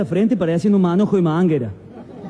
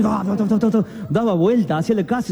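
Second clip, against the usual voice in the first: normal talking voice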